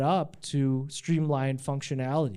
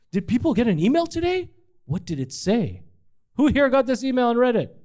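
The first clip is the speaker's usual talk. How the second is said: calling out; 9 semitones higher, 5 dB louder than usual. Real, not acted. Asks the room loudly.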